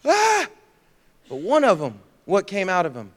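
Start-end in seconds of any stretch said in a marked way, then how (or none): 0.0-0.5 short, very high yelp; acted cry of fear; mock fearful scream. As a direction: yelp as the scared disciples.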